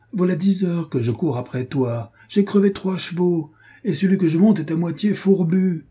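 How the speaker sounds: winded rider. Acted. Renaud brags to his friend about the chase, excitedly. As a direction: boast of the ride, still puffing.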